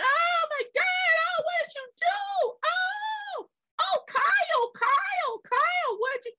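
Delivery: very high, wailing cries of Kyle; screaming women. Retold or imitated. Retelling the women who saw the shooting, high panicked wailing. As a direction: shriek it like a panicked onlooker. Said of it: "all the women screaming and hollering".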